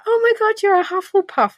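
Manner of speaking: high mock gush over the result; imitating gushing friends. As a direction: squeal it like a thrilled friend. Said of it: "they were like".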